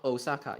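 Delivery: Osaka said English style, stress on SA, long oh; wrong Osaka. Shown as a demonstration. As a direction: Osaka as oh-SAH-ka, anglicised.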